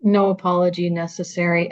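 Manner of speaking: ordinary talk, nothing marked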